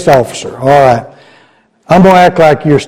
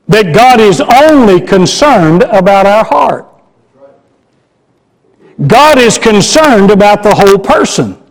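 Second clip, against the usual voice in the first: rising preaching; 5 semitones higher than usual. Acted. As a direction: preach the verse with swelling force. Builds into preaching on the verse.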